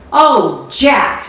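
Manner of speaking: a mother's angry wail at her son; ma scolding her son Jack, loud and upset; cried out in anger. Acted. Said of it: "she cried".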